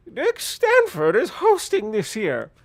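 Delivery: put-on high, loud, slow old woman; proud old mother gushing; old lady voice. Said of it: "old lady voice".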